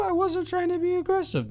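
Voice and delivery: high, fast whiny mimic of her own line; mocking repeat. Mocking partner's question in a high squeaky parody. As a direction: parrot it back in a squeaky mocking voice. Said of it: "I was just said".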